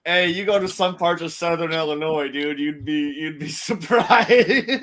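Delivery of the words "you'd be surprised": laughing through "surprised", slowed right down; giggling as the story gets funny. Real, not acted. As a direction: let the laugh break into "surprised".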